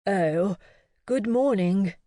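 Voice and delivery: a touch of an upper-class English accent, lightly voiced; a gentleman with a lightly done upper-class English accent. A narrator's reading. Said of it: "in an upper-class accent".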